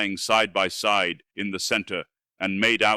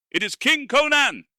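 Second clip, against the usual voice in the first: high, loud cry of dread; terrified shriek; 5 dB louder than usual. Acted. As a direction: shriek the name in horror. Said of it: "shrieked Strabonus".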